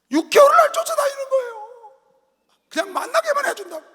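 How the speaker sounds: high, loud, half-crying exclamation; exasperated outcry. Real, not acted. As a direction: cry it out in disbelief. Exasperated retelling. (울먹이며 높이 외치듯)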